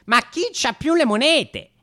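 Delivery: ordinary talk, nothing marked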